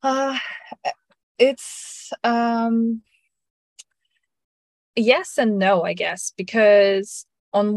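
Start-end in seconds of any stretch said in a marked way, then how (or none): none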